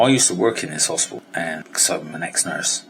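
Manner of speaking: ordinary talk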